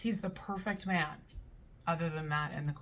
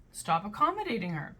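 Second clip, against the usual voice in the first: ordinary talk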